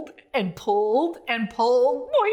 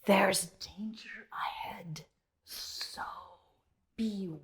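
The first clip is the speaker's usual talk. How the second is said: whispered warning of danger; hushed warning; 5 semitones lower, 9 dB quieter than usual. A narrator's reading. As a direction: breathe it out like a secret. Narrator warns of danger.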